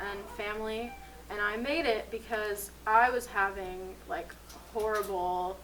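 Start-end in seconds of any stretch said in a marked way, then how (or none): none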